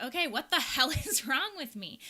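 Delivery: loud laughing disbelief; laughing exasperation. Retold or imitated. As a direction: throw up your hands and laugh it out. Retold to husband, laughing.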